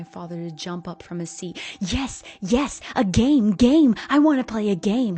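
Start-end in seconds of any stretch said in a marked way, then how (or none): 1.8-5.2 put-on loud, higher, overjoyed child shout; childlike excitement. A narrator's reading. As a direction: bounce with eager glee. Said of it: "like an overjoyed child".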